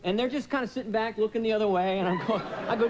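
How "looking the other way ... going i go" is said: normal talking voice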